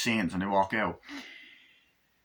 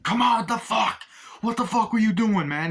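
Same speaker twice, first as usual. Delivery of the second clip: high, loud furious shouting; angry yell; 10 semitones higher, 4 dB louder than usual. Retold or imitated. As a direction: yell at him in outrage. Retold argument continues.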